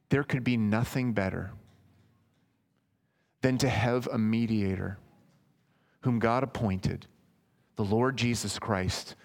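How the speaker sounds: usual speaking voice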